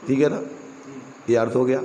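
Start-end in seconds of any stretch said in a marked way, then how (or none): none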